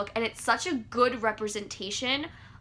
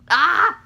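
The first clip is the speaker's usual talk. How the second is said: loud, short "ah!" of frustration; frustrated yell; 6 semitones higher, 11 dB louder than usual. Real, not acted. Fan waiting for the next book, frustrated yell. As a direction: let out an exasperated fan's cry.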